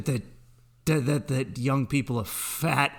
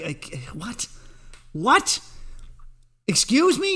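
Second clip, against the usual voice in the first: mock baffled disbelief; 6 semitones higher than usual. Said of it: "old me was just like".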